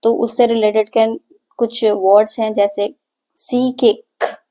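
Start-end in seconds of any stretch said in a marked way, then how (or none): none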